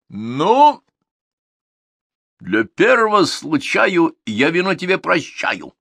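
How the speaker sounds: a hint of a smug king; condescending tsar. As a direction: tsar pardoning Ivan. Tsar forgives.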